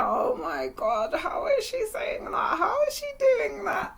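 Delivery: high-pitched, squirming disbelief; cringing. Retold or imitated. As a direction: squeal it like you can't bear to watch. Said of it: "I used to cringe".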